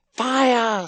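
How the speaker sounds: very high-pitched cry of alarm; screamed. A narrator's reading. Sister screaming the alarm in her brother's ear. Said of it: "screamed Margot in his ear".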